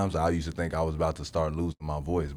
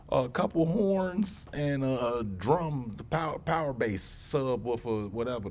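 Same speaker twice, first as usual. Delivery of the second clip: much higher and excited; 8 semitones higher than usual. Real, not acted. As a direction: get worked up listing it. Stripping down the beat.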